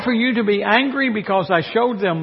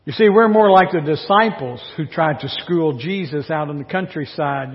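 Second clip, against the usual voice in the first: ordinary talk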